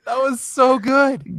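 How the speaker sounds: laughing. Real, not acted. Laughing praise of the accent.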